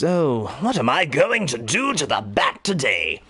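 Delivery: slow scheming Joker voice; sinister voice. Retold or imitated. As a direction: plot it slowly, sly and sinister. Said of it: "you kinda have this sinister voice".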